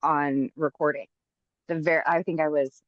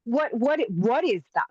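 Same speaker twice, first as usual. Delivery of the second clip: higher, startled "who is that?"; a retold jolt of surprise, pitched up; shocked disbelief; 8 semitones higher than usual. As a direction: recoil at hearing your own recorded voice. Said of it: "and I was like".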